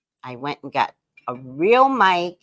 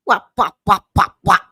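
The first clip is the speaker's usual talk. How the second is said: loud wop chant; 3 semitones higher, 7 dB louder than usual. Real, not acted. Showing off new mic.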